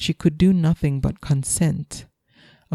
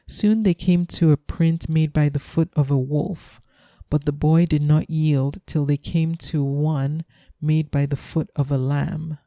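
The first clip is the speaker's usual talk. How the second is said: ordinary delivery, nothing marked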